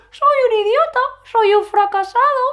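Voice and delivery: very high and loud like Donald Duck; squeaky cartoon voice. Shown as a demonstration. Teacher mocking the thought with a cartoon duck voice. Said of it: "Para empezar a hablar así".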